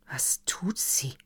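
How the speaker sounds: hushed, slow whisper; quiet uneasy whisper; asked quietly. A narrator's reading. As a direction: whisper the question under the breath. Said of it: "fragte Herr von Wallbaum leise".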